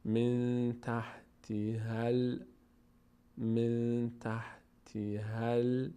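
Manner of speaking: من تحت هل drawn out very slowly; slow recitation. Shown as a demonstration. Teacher modelling the joined reading. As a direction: stretch each syllable of من تحت هل.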